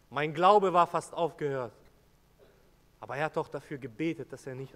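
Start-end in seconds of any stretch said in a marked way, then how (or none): 0.1-1.7 anguished outcry. Acted. Peter's repeated lament, louder.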